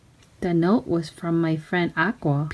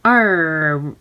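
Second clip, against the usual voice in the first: pirate yell; 4 semitones lower than usual. Acted. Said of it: "yelled the pirates".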